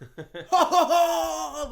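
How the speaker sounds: loud high laughing ho ho ho; hammed-up Santa ho ho ho; Father Christmas ho ho. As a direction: boom out a mock Santa laugh.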